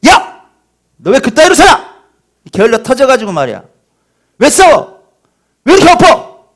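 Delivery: put-on screaming tirade at a small child; harsh scolding parent. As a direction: bellow at the kid for spilling. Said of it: "너무 리얼하죠".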